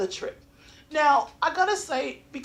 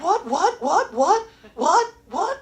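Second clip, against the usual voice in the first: high, rising, repeated questions; mock bewilderment, pitched up; baffled; 5 semitones higher, 4 dB louder, slower than usual. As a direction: pepper them with bewildered, rising whats. Baffled by fast talking waitress.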